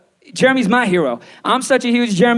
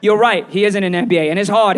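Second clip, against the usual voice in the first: ordinary talk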